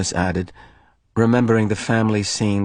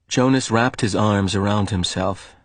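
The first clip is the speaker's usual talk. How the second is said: usual speaking voice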